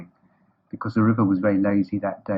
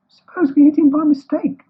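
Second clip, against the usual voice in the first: very high, loud worried squeak; Piglet speaking anxiously; 8 dB louder than usual. A narrator's reading. Piglet fearing they will hit Eeyore, anxious squeak. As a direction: squeak the worry in a tiny voice. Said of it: "said Piglet anxiously".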